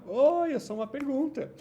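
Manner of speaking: high, loud cheerful self-introduction; the auxiliary do introduces itself, high and loud; cartoon hero greeting. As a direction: announce yourself like an eager anime hero. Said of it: "pra dizer assim, ó".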